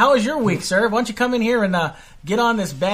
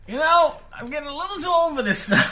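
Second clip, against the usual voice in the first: put-on weary old fan; 9 semitones higher than usual. Voicing a tired fan.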